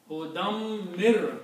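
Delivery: hudam mir slow, linked, doubled m; staccato. Shown as a demonstration. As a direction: say hudam mir slowly with held m. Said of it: "Even though separately".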